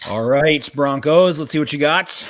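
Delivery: rousing holler at the Broncos; calling out. Real, not acted. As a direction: call it out high and loud. Calling out to team on the clock.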